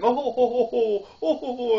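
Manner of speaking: high, loud creepy ho ho ho; creepy Santa laugh. Retold or imitated. Imitating the creepy movie Santa laughing at the nativity scene. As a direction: ham up a sinister Santa chuckle. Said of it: "it's just a creepy laugh".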